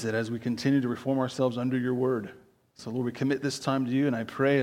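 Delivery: hushed, running pulpit prayer. Real, not acted. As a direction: pray quietly and evenly on the congregation's behalf. Leads a brief prayer.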